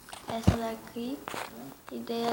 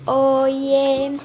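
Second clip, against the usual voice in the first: loud, drawn-out hello; acted greeting; 8 dB louder than usual. Friend answers the video greeting, loud drawn-out hello. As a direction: call out a long imitated hello.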